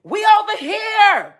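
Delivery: high, loud mock shout across the room; imitating a crowd hollering for attention; mocking their call. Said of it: "Y'all said".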